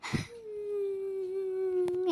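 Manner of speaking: long, high, soft wail; high keening moan, softly trailing away; crying wail. Acted. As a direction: draw out one thin sob. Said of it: "ಯಾಕೆ ಅಳ್ತಾ ಇದೀಯಾ".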